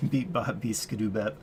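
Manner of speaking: usual speaking voice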